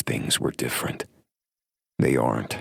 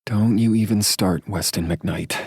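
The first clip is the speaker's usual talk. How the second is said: higher, louder mock scolding; playful warning; 8 semitones higher, 4 dB louder than usual. A narrator's reading. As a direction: scold him while holding back a laugh. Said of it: "she warns with a wagging finger, trying not to laugh".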